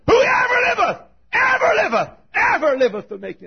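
very high, loud, ringing cry; triumphant pulpit wail; climactic preaching shout. Real, not acted. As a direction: cry it out, easing off at the end. Climax of the sermon.

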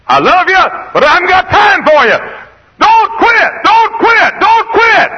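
very high, loud pulpit yell; full-force preaching shout. Acted. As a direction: cry it out at the top of your range. Preacher erupts at quitters.

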